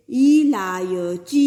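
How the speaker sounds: इलायची with the ई drawn out long; इलायची said slowly, long ई held; lengthened long ee. Shown as a demonstration. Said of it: "इसका उच्चारण हमें दीर्घता से करना चाहिए".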